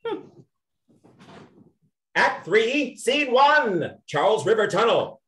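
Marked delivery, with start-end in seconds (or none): 2.1-5.2 announcing the act and scene; a narrator's scene announcement. Acted. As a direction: read it like a stage heading.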